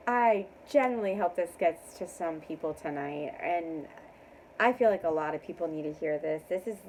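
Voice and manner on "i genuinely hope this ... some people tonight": ordinary talk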